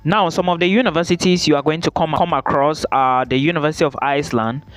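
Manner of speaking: normal talking voice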